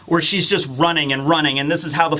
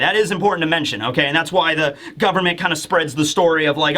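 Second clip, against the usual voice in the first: usual speaking voice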